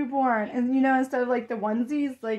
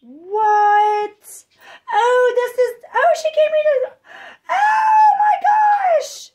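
gasping, crying out in disbelief at the gift; happy tears; 11 semitones higher, 7 dB louder than usual. Real, not acted. Opening a friend's baby gift, crying out in joyful tears. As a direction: voice cracking high with overjoyed tears. Gift box reveal, overwhelmed.

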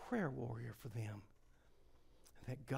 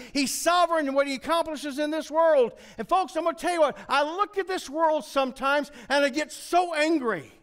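loud, high, ringing preacher's cry; full-force preaching; 16 dB louder than usual. Acted. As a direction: preach it out to the back pew at full power.

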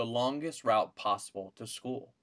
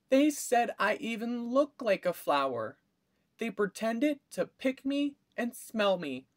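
put-on little girl voice; 11 semitones higher, 4 dB louder than usual. A narrator's reading. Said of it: "said Chrysanthemum".

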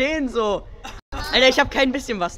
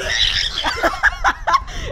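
shrieking laugh. Real, not acted.

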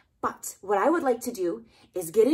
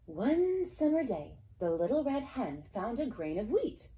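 put-on reading to a toddler; 4 semitones higher than usual. A narrator's reading. Story narration.

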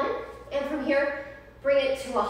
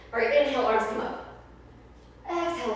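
ordinary talk, nothing marked

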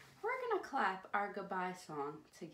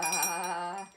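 loud, low "baa"; sheep bleat; 5 semitones lower, 6 dB louder than usual. Pretend. Host asking kids if they see her, lamb bleat. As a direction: give a short lamb's bleat.

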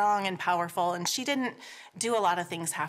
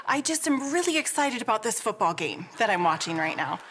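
high, loud rush of reassurance, easing down; acted-out mommy voice, high and excited; reassuring excited mom; 8 semitones higher, 5 dB louder, faster than usual. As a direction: imitate a worked-up mom hurriedly soothing her child. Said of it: "and she goes".

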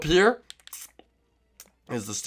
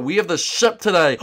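normal talking voice

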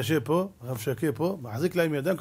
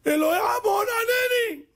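desperate shout; slower than usual. Retold or imitated. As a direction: cry out in panic from the burning pot. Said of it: "הוא מתחיל לצעוק". (צעקה נואשת וגבוהה)

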